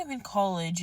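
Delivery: normal talking voice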